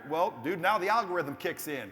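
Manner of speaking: normal talking voice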